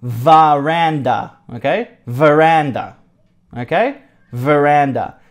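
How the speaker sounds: "Veranda" drawn out slow and clear, over and over; slow model word. Shown as a demonstration. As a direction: drill "Veranda" for learners. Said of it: "We call this veranda".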